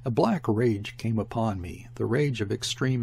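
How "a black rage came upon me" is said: ordinary delivery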